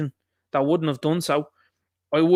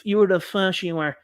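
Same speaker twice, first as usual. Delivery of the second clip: mock accusing; 4 semitones higher, slower than usual. Mimicking name-calling political opponents.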